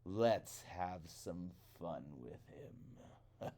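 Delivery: slow, low, soft sly crow; Old Man Crow. Acted. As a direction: plot mischief slowly and low. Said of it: "Old Man Crow said".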